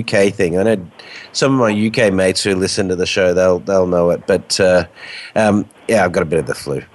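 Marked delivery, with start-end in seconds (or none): none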